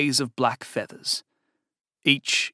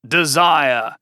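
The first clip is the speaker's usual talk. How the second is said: desire leaned on, louder; emphasis on desire; 5 dB louder than usual. Acted. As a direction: punch the word desire. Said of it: "I emphasize the word".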